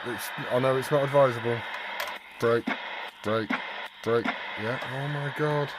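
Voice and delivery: ordinary talk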